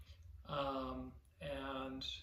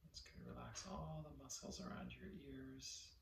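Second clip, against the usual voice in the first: guided meditation; 3 semitones lower, 13 dB quieter than usual. Real, not acted. Teacher leading listeners to relax their body, soft guided meditation. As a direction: softly guide them to let go, phrase by phrase.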